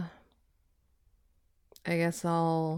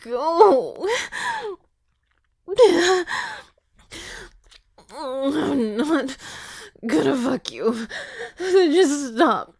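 tearful; 5 semitones higher, 5 dB louder, much slower than usual. Acted. Crying refusal.

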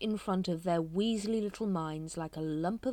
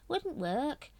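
dourly; 9 semitones higher than usual. A narrator's reading. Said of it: "said Brother Watchtower dourly".

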